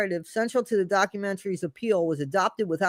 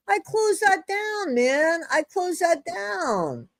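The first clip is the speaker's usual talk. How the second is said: higher, slow, drawn-out whine of disbelief; mock dismay; 10 semitones higher, slower than usual. Real, not acted. Host whining to viewers about the open chat, drawn out and slow. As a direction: stretch each word like you can't believe it.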